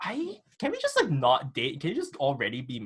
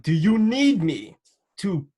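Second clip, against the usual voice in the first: slow, louder, higher exasperated challenge; 7 semitones higher, 7 dB louder, slower than usual. Real, not acted. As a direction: drag it out in disbelief. Exasperated with people doubting apology.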